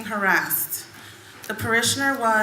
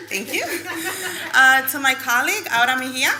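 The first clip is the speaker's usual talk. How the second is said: laughing; 4 semitones higher, 5 dB louder than usual. Real, not acted. Laughs introducing colleague.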